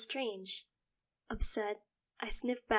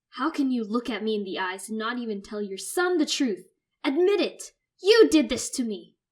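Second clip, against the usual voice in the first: furious shouting; 3 semitones higher, 13 dB louder than usual. Acted. Son screams accusation.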